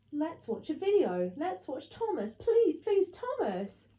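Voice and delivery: put-on high, swooping childish plea; begging small child. A narrator's reading. Little Maxie begging to watch Thomas, pleading. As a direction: pester and plead, voice shooting up on please. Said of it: "Maxie begged".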